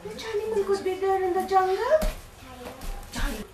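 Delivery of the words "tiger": usual speaking voice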